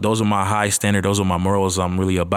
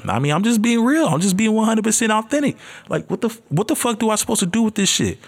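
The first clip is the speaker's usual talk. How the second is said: a first-time podcaster venting his nerves, pitched way up; nervous excitement; 14 semitones higher than usual. Real, not acted. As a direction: blurt it out in a high, jittery rush. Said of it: "I'm nervous as shit right now".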